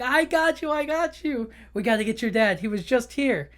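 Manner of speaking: high, eager I got you; rallying the lost fish friend; Dory snapping back into action; excited Dory. Retold or imitated. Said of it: "And she's like".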